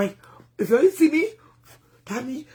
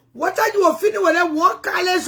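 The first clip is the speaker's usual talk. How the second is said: higher, louder "What are you feeling with that one college?"; pointed repeat; 6 semitones higher, 4 dB louder than usual. Acted. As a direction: push the question up and louder, teasing. Question repeated, second more pointed.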